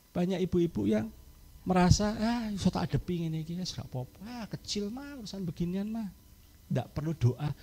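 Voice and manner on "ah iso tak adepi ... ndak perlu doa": dismissive mutter. Acted. Careless mother brushing off prayer.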